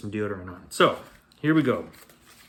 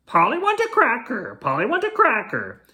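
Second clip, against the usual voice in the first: high squawky parrot voice, much higher; squawking parrot; 9 semitones higher than usual. Acted. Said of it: "Parrot Polly squawked".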